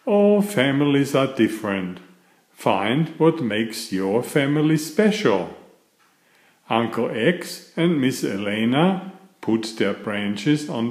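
cheerful bedtime story reading; reading to children. A narrator's reading. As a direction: read it like a picture book to little kids. Reads picture book to children.

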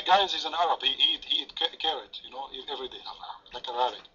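normal talking voice

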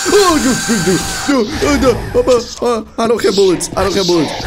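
high, loud panicked pleading; panicking, out of ammo; scared horror game streamer. Real, not acted. Monster attacks, no ammo.

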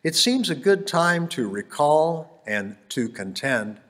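rhythmic Dr. Seuss style reading; bouncy galloping verse. Acted. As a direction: bounce through the rhymes playfully. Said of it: "wrote in Anapestic tetrameter, and it has that rhymy sound".

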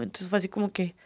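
ordinary talk, nothing marked

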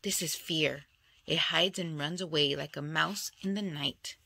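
ordinary delivery

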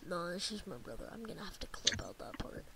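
whispered aside, hushed. Real, not acted. Brother whispers an aside to co-host, whispering. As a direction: whisper it so the mic misses it.

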